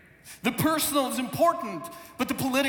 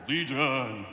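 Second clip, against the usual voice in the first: low demon voice; 9 semitones lower than usual. Acted. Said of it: "Or perhaps it's more irritating".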